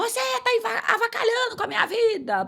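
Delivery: high-pitched retold rant at God; high mock complaint; mock angry outburst. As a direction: throw it up high like a rebel.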